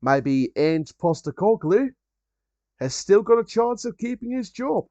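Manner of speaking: put-on slow, drawn-out Australian accent, lightly done; a light mock Australian drawl; Ange Postecoglou's accent. Joking about the Tottenham manager keeping his job, in a light Australian accent. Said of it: "I'll never get tired of that accent".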